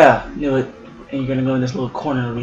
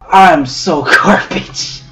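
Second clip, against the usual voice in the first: self-mocking laugh; 3 semitones higher, 8 dB louder, much slower than usual. Real, not acted. Laughing at own bad play.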